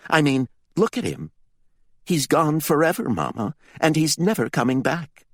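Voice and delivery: put-on a boy blurting out what he saw; boy's urgent account. A narrator's reading. Jalo tells his mother how his brother died, high, pressing voice.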